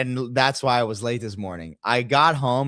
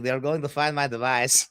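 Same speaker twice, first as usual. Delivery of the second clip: higher-pitched mock Israeli accent; joking Israeli accent; 4 semitones higher than usual. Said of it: "I said it in the Israel accent".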